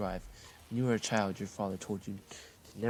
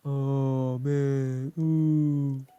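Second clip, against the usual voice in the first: loud, high dazed groan; groggy moan; 4 semitones higher, 9 dB louder, much slower than usual. Acted. Player wakes from the vision, loud dazed groan. Waking up from hallucination.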